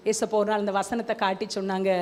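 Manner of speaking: ordinary talk, nothing marked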